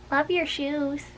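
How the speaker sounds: soft, hushed compliment; said real soft. A narrator's reading. Young girl softly sweet-talking her teacher. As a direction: soften it right down to Missus. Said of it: "I said real soft".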